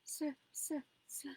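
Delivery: high, small stuck stutter; can't get the word out for crying; stammering sobs. A narrator's reading. Terrified girl stutters through sobs to her mother. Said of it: "stammered between sobs".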